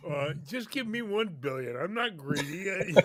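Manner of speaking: ordinary delivery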